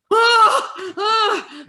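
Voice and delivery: high loud laughing yelps of fright; retelling being scared by the second Purge; mock scared yelps. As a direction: yelp as if the movie scares you. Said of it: "and I'm like".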